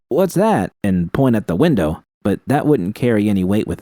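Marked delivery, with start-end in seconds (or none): 0.1-0.7 high pretend shout; a sudden mock alarm call; imagined shout. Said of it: "I could always shout".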